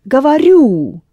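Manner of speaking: Gavaru with the final RU stressed and loud; stress on the ending. Shown as a demonstration. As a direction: punch the last syllable of Gavaru. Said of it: "accented on the ending".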